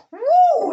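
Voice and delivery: high, loud mock "Woo!"; playful imitated whoop; lights going woo. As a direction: yelp it like an excited light. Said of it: "they go".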